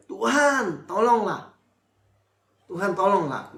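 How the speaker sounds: "Tuhan, tolonglah" called out high and loud, twice; preacher acting Peter crying "Tuhan, tolonglah" to be saved; crying out for help. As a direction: cry out "Tuhan, tolonglah" like a sinking man pleading. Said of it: "Lalu berteriak, cry bahasa Inggrisnya".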